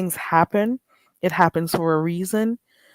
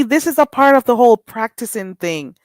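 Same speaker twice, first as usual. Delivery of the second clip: ordinary delivery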